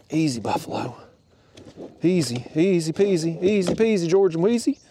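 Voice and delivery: high, sing-song patter; playful coaxing. Real, not acted. Angler settling a buffalo at the net, playful coaxing. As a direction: coax it along in a high rhyming lilt. Playfully soothing a fish being netted.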